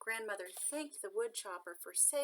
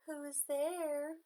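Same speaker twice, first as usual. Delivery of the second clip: put-on higher, slow and louder old granny call; frail grandmother calling; 7 semitones higher, 5 dB louder, slower than usual. A narrator's reading. Grandmother calls from her bed.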